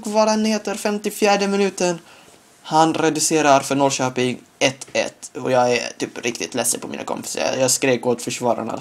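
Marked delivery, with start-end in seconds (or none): none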